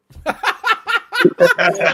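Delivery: big high burst of laughter; laughing. Real, not acted. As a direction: crack up loudly. Joking about one swole arm.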